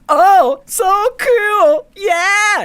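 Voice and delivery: put-on very high, loud girlish squeal; high, slow squealing girl wanting to marry a foreigner; dreamy girl voice. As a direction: gush like a starry-eyed college girl. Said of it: "She was just like".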